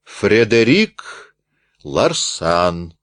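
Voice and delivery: slow, rolling name; rolling repeat. Acted. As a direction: roll the name out slowly. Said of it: "раскатисто повторил Рультабиль". (раскатисто, медленно)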